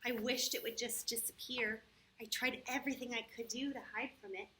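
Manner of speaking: put-on storybook narration for children; story reading. A narrator's reading. Teacher reads the problem story to the kids, storybook voice. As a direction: read it like a picture book aloud.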